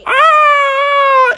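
long, loud high wail; high cartoon shriek; ghost's scream. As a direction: scream as if being sucked away. Slimer sucked into the trap.

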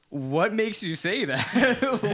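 slow, laughing disbelief. Retold or imitated. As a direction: laugh through the question. Laughing retold reply.

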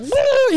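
high, loud "woo" as if zapped; sudden high comic whoop; electric-shock yelp. Retold or imitated. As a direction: yelp like a cartoon character getting shocked. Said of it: "He gets shocked".

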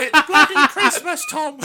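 very high, loud, drawn-out mock villain; Voldemort parody. Friends riff on the villain at Christmas, shrieky parody. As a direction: stretch it into a high comic shriek. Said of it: "It's Voldemort".

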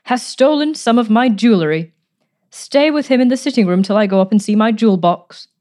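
a lightly voiced harsh aunt. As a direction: point at him with a hint of harshness. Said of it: "in her harsh, grating voice".

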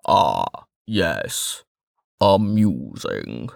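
low and very slow; solemn Westbridge. A narrator's reading. Westbridge announcing the moment, low and slow. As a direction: weigh every word.

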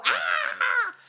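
a quick yelp to scare the rats off; little scream. Retold or imitated. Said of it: "Your little scream there".

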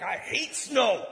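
acted snow-shoveling rage; angry. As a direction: lean hard and slow into the hate. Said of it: "and I'm just angry".

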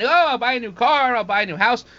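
high, giddy imitation of a careless spender; mock spender. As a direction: toss it off like a giddy shopper. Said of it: "They just don't spend".

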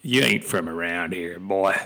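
a hint of a backwoods Southern accent, slow and teasing; mock Southern drawl. Tennessee local grilling a stranger, hammed-up Southern accent. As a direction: tease like a suspicious Southern local. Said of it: "when I ask".

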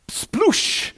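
a breathy, high "sploosh" like soda spilling; splash noise. Retold or imitated. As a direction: spit out the splash sound. Splashing Coke sound effect.

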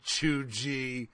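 "chuggy" drawn out as choo-jee; mocked mispronunciation. Host repeating co-host's mistake, mocking mispronunciation. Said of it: "you pronounced it chuggy".

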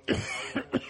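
sudden dry cough; real cough. Real, not acted. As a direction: cough mid-sentence. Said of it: "excuse me".

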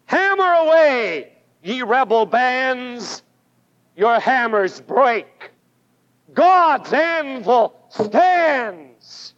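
slow, declaimed poem with a ringing start; solemn poetic recitation; recited verse. Shown as a demonstration. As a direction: recite it like a hymn, drawing out "God's anvil stands". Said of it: "I don't know who wrote these lines".

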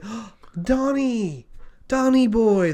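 high, drawn-out welcome; delighted greeting. Acted. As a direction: greet a long-lost hometown boy in a high, happy voice. Townsfolk greet returning hometown man.

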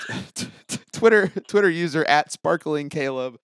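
hyped. Real, not acted. Hyping up the guest.

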